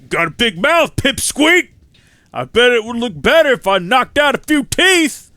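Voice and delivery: loud, high bellowing threat; thug's shout. Acted. Street thug threatening the hero, loud shout. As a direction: yell it like a brawler itching to fight.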